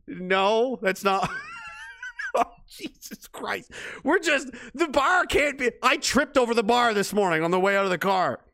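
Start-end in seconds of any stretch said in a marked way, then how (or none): none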